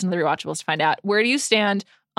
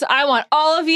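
mild mock yell, pitched up; Noah; 6 semitones higher than usual. Quoting a favorite scene to the co-host, half-yelled. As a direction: raise the voice as if quoting a movie outburst. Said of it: "when he yells".

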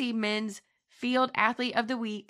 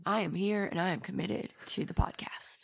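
hushed, voiceless whisper; 3 semitones lower, 3 dB quieter than usual. Real, not acted. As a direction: whisper it devotedly. Sick host whispers commitment.

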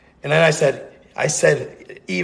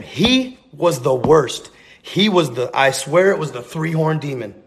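plain reading voice

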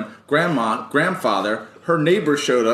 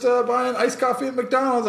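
mock casual girl; 12 semitones higher than usual. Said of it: "And she's like".

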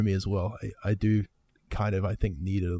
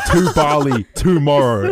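laughing; 3 semitones higher, 6 dB louder, slower than usual. Real, not acted. Laughing at booked flight.

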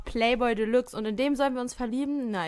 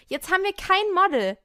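calling out; 9 semitones higher, 6 dB louder than usual. Acted. Brand calls out.

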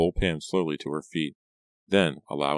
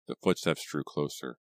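plain reading voice

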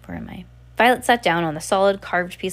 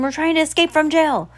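higher, louder exasperated outburst; exasperated cry; 9 semitones higher, 5 dB louder than usual. Acted. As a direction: throw up your hands at him. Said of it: "Violet cried in exasperation".

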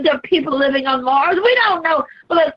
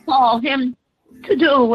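ordinary delivery

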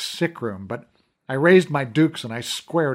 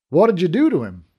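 sharp accusing question, higher and louder; 5 semitones higher, 6 dB louder, faster than usual. Acted. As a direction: demand it like an accusation. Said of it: "in an accusing tone".

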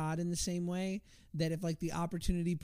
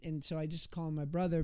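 usual speaking voice

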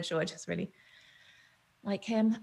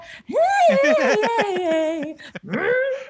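high belted growl note; vocal growl; 4 dB louder than usual. Retold or imitated. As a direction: let a diva growl rip. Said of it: "my mum's going into the growl".

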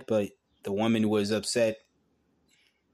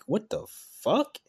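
high, stunned "what the fuck" at the news; real disbelief breaking out mid-read; shocked outburst; 11 semitones higher, slower than usual. Real, not acted. Reacting to a grim article, shocked. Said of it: "This is me, my reaction".

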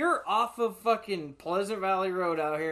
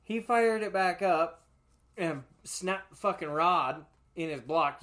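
usual speaking voice

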